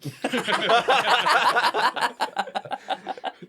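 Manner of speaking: big high cackling laugh; loud burst of laughter. Real, not acted. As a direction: crack up loudly. Friend laughs at story.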